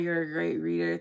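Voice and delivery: ordinary talk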